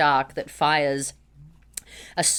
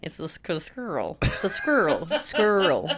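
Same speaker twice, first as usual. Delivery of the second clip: Australian accent; slower than usual. Shown as a demonstration. Trying the Australian "squirrel" for friends. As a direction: stumble over "squirrel" the Aussie way. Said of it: "I cannot say it in an Australian accent".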